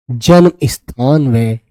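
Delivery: usual speaking voice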